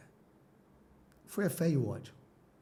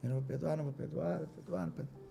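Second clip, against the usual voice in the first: stubborn refusal fading; 8 dB quieter, faster than usual. Retold or imitated. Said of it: "Eu falei".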